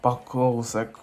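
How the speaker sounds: ordinary delivery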